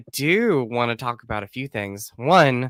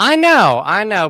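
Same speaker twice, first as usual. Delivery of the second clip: much higher and louder, bursting in to agree; eager agreement; 9 semitones higher, 10 dB louder than usual. Real, not acted. Host eagerly agreeing with his podcast buddy, high and loud. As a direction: jump in, thrilled to agree.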